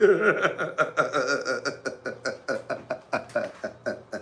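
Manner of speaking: drawn-out stuttering chuckle, fading away; long staccato laugh. Pretend. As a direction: rattle out a laugh that slowly dies down. Long laugh after hype catchphrase.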